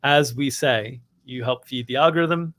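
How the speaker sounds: usual speaking voice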